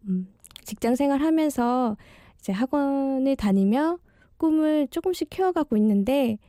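ordinary delivery

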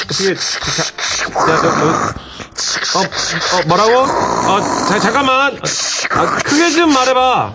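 straining to hear over noise; shouting into the phone over the din; to someone hard of hearing. Acted. Phone call over factory noise.

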